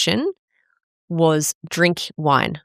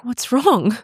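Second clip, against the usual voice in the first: imitating concerned partner; 7 semitones higher than usual. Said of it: "He's like".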